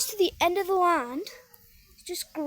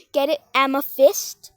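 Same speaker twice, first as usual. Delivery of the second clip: usual speaking voice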